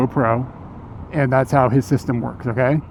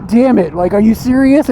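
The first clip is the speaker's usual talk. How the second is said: mock exasperated, high and loud; exasperated swearing; 8 semitones higher, 5 dB louder than usual. Streamer cursing at easy fix, mock exasperated. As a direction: vent like the fix was too easy. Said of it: "He was like".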